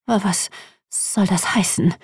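a touch of fear in the question; frightened. A narrator's reading. Madame Wu asks the threatening customer, softly frightened. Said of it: "klang die alte Frau ängstlich".

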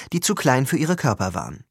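ordinary delivery, nothing marked

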